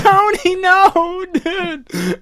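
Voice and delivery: loud, high mock wail of grief; retold crying shout. Boy losing his fish Tony Hawk, high anguished shout. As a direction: cry out to the lost pet, hammed-up. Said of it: "And I remember crying".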